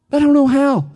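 high, put-on whine; whiny excuse. Preacher voices someone dodging God's call, whiny excuse.

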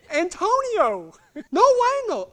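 high, loud comic wail; protesting. Retold or imitated. Rider to trainer about the cactus plan, high wailing protest. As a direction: wail it in mock dismay.

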